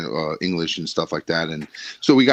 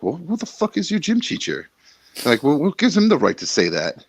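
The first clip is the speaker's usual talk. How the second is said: higher, indignant mom voice; mom telling him off; 7 semitones higher than usual. Retold or imitated. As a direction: play the outraged mom who can't believe it. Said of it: "And she's like, she's like".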